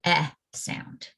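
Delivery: short vowel EH said alone, clipped; short e sound. Shown as a demonstration. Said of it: "they need to try the short".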